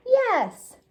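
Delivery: high, loud cheerful yes; excited yes. A narrator's reading. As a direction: answer with a delighted yes. Bright answer to question.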